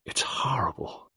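a hushed, hoarse, slow whisper; barely voiced horror. A narrator's reading. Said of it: "her voice deep and hoarse".

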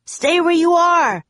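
high, loud warning shout. A narrator's reading. Jack warns Annie to stay back, shouting. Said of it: "Jack shouted".

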